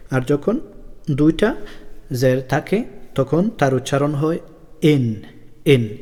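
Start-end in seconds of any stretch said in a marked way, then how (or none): 4.8-6.0 ইন with a clear nasal n ending; tanween in sound. Shown as a demonstration. Teacher shows children the double-jer sound, clearly. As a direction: sound out ইন twice, close on the n. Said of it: "এভাবে".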